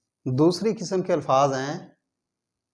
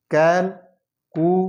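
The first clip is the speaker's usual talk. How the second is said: slow syllable drill; 3 semitones higher, 4 dB louder, slower than usual. Shown as a demonstration. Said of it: "syllable drilling".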